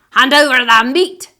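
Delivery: loud greedy captain's shout; pirate demand. Acted. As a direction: demand it from across the table.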